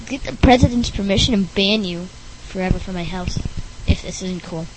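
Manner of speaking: ordinary talk, nothing marked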